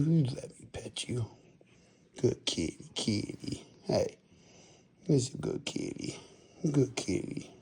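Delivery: soft whisper to the cat. Real, not acted. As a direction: hush it gently, under the breath.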